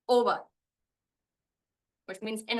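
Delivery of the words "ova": "ova" as OH-va, falling off the O; stress on first syllable. Shown as a demonstration. Teacher repeats the right option, stress on first syllable of "ova". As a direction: put the weight on O in "ova", OH-va. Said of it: "is the one that has the stress".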